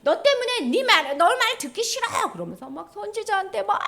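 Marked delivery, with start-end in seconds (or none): 0.0-2.3 high, loud mock protest; indignant, high-pitched grumbling outburst; angry crowd complaining. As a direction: whine angrily at the prophet, pitched way up. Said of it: "화를 내는 거예요".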